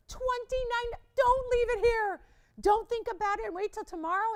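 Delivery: loud, high, insistent plea to buy; pressing seller at full volume; urgent sales pitch. Real, not acted. Seller urges viewers to buy now.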